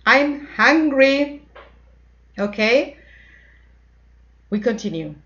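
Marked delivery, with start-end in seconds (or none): 0.1-1.4 put-on high, loud, slow child whining to mom; a hungry child calling to mom for food. Shown as a demonstration. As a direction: whine for food like a hungry kid. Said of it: "you say".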